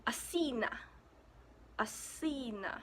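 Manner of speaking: Asina, soft s, stress on si; Greek pronunciation. Shown as a demonstration. Vlogger giving Athens in Greek, careful Greek pronunciation. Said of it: "in Greek, it is".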